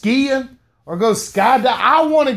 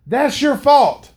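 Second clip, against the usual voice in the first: ordinary delivery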